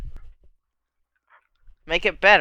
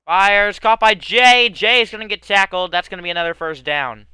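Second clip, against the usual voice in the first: loud, excited sports call; play-by-play; 2 semitones higher, 6 dB louder than usual. Acted. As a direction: call the catch like an announcer. Play-by-play burst.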